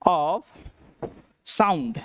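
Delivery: Study, of, sound said slowly with pauses between; teacher spacing out the phrase; spaced out. Shown as a demonstration. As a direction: pause between each word for the class. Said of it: "क्या होता है".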